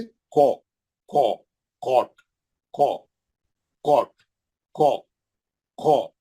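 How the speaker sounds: को with a heavy, rounded "aw" vowel; thick, round-mouthed. Shown as a demonstration. Teacher drills the heavy letter with students, round-mouthed and thick. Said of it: "आपका मुंह गोल रहता, आवाज मोटी रहती".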